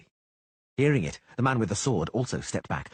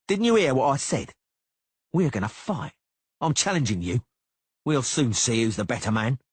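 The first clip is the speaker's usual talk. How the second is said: higher, louder challenge; angry challenge; 5 semitones higher, 5 dB louder than usual. A narrator's reading. Cicada challenging the Witcher, higher and louder. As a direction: push him to fight.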